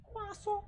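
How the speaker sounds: croissant as wɑsə, high but soft; a high, soft mock French croissant. Streamer repeating croissant softly in mock French. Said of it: "French accent".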